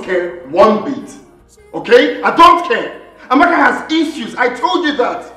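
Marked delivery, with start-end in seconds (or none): 2.2-3.0 loud, forceful refusal; heated defiance. Acted. Son insisting to his mother, heated defiance. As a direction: hit "I don't care" hard with raised voice.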